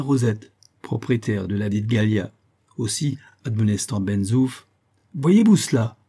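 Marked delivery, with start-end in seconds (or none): 5.1-6.0 high, loud indignant outburst; exclaiming. A narrator's reading. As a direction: snap it out in scornful disbelief. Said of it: "s'écria-t-il".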